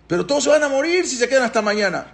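high-pitched alarmed protest; acted panic. Paró fearing all will die before morning, high acted panic.

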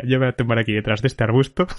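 ordinary talk, nothing marked

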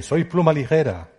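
loud and high, calling out; shouted. A narrator's reading. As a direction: shout it across the valley. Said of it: "Así que yo grité". (grito alto y fuerte)

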